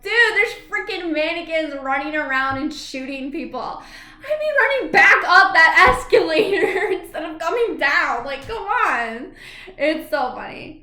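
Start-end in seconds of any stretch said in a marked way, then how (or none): 0.0-9.3 exasperated. Retold or imitated. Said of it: "I'm like".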